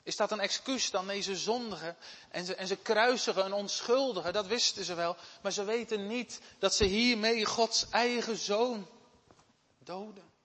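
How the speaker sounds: loud, high, urgent preaching; fervent preaching. Acted. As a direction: preach it out to the congregation. Preaching at full force. (luid en vurig preken)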